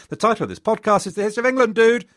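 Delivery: high, fast mock-outraged listener; hammed-up protest in a high-pitched rush; exasperated exclamation. Imagined listener protesting to the podcaster, mock exasperation. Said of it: "and you are exclaiming".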